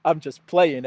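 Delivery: chuckling through the words; laughing. Real, not acted. Admitting to viewers it was a joke, laughing. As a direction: laugh it off lightly.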